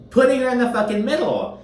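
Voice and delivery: high, loud indignant burst; outraged aside. Real, not acted. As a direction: spit it out in disbelief. Outraged aside about Lisa.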